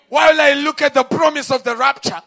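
roaring preacher's cry; preaching at full force. Real, not acted. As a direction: preach it out to the crowd. Preacher building up.

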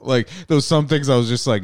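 ordinary delivery

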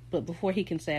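ordinary delivery

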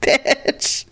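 slow, high, through laughter; laughing self-reproach. Real, not acted. Wife admitting she was mean to husband, laughing self-reproach. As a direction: confess it while cracking up. Laughing at her own meanness.

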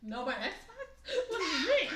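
high, loud mock protest; hammed-up kid's outcry through laughter; dismayed child. Punished kid losing the Xbox, mock dismay.

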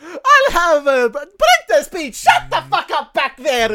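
high, loud, slow cartoon order; Mario. Customer ordering at the drive-thru, loud Mario voice. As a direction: bellow like a game plumber at the speaker box. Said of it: "just talk like Mario".

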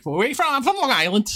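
put-on high-pitched Long Island accent. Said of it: "So it's like Long Island".

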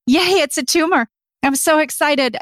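high-pitched retold cheer of an excited daughter; super excited. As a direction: imitate a kid cheering, pitched well up. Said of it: "My daughter was super excited".

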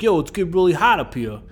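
hammed-up Brooklyn guy complaining; Brooklyn accent. DeSalle reporting the heat to Kirk, mock Brooklyn accent. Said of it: "DeSalle's from Brooklyn as well".

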